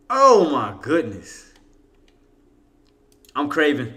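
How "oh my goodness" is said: slow, drawn-out oh my goodness; slow rapturous oh my goodness; drawn-out rave. Real, not acted. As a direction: stretch it out in delight. Raving about food.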